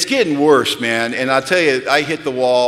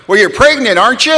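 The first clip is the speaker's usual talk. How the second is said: nervous blurted question; 10 semitones higher, 9 dB louder than usual. Retold or imitated. Digging deeper with a client.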